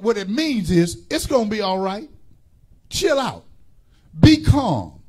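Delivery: loud, rhythmic pulpit preaching; fiery Sunday sermon delivery; preaching at full force. Real, not acted. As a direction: drive each line home to the congregation. Preaching to congregation.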